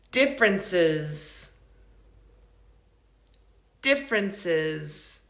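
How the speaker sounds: "Differences" said slowly and low; model for repeating. Shown as a demonstration. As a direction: spell out "Differences" by ear, unhurried, for learners. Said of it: "and then repeat after me".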